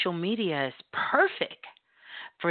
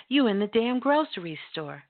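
ordinary talk

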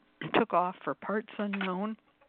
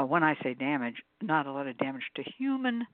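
ordinary talk, nothing marked

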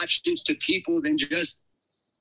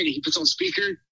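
ordinary talk, nothing marked

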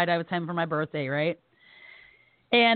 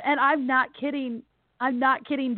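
normal talking voice

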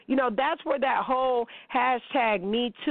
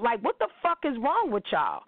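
ordinary talk, nothing marked

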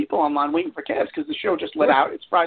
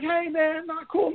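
higher-pitched imitation of angry bystanders; retold complaint, raised in pitch; mock protest; 7 semitones higher, slower than usual. Everyone's yelling at me like.

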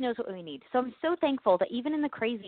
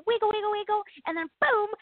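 playful magic wiggle; 10 semitones higher than usual. Pretend. Mimes Bewitched nose magic.

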